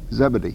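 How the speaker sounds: short "zeb" opening on Zebedee; alternative pronunciation. Shown as a demonstration. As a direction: say Zebedee low, as ZEB-uh-dee. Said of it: "however you say it".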